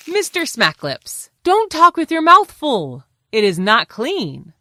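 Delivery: loud, high stern teacher; loud scolding. Acted. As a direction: scold him firmly. Fox scolding.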